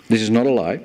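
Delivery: ordinary delivery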